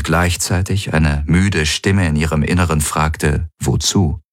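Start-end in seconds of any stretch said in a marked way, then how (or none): none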